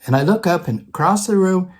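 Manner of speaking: usual speaking voice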